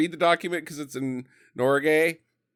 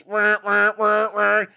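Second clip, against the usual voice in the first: repeated whirring siren noise, higher than usual; imitated alarm; 4 semitones higher, 5 dB louder, slower than usual. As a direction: mimic a blaring alarm with your voice. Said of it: "And alarms".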